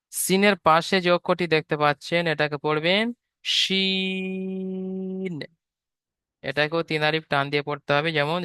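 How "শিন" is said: শিন with a thick sh, drawn very long; thick long shin. Shown as a demonstration. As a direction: hold শিন broad and long. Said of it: "শিনের আওয়াজটি হবে একটু মোটা আওয়াজে". (মোটা আওয়াজে লম্বা টেনে শিন)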